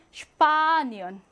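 슈파니언 with 파 stressed and drawn out. Shown as a demonstration. Teacher showing stress before ie, 슈파니언 with long stressed 파. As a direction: hold the 파 in 슈파니언, light 니언. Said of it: "여기 강세가 오기 때문에".

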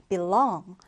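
"belong" stressed on LONG; light be, strong LONG in "belong"; second-syllable stress. Shown as a demonstration. Teacher introducing a new word, "belong" with stress on the second syllable. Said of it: "두 번째 음절에 강세를 두고".

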